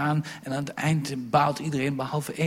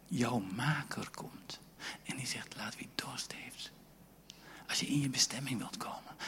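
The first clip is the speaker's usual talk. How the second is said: soft, whispered, intimate appeal; 11 dB quieter than usual. Acted. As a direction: draw them in with a whisper. (zacht fluisterend pleidooi)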